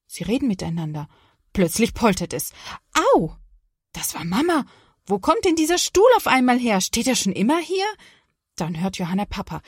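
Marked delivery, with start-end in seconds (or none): 3.1-7.9 annoyed mom. Acted. Mother bumps into chair.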